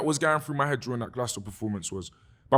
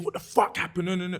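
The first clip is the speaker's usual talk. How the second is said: higher-pitched re-enacted outrage, not shouted; retold anger; 6 semitones higher than usual. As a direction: mimic an angry demand without yelling. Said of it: "at first it was anger".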